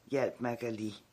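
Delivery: usual speaking voice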